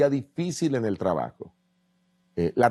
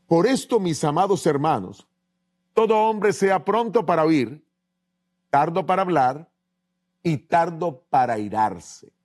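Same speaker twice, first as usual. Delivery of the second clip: scripture reading; 4 semitones higher than usual. Acted. Reads Santiago 1:19 aloud.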